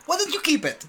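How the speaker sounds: high, sweet-talking mom voice; imitated mother. Imitating mom regifting the hat, high coaxing voice. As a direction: offer it like a doting mom. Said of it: "she's like".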